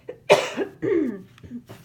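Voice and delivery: quick dry cough. Real, not acted. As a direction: cough to clear the throat. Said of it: "get rid of this tickle in my throat".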